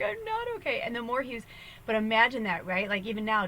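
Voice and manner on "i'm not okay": mock crying wail. Ron sobs into the phone again in a hammed-up wail. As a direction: whimper it again, still falling apart. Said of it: "he's screaming and he's crying".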